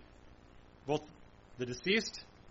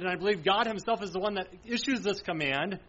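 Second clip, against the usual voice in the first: usual speaking voice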